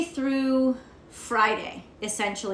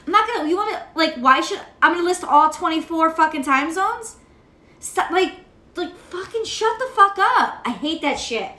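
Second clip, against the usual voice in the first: climbing higher, exasperated; rising rant; 6 semitones higher than usual. Real, not acted. Ranting at commenters about time zones, pitch climbing angrily. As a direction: let the frustration spike up. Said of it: "that's my little rant".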